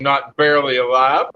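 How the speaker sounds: ordinary delivery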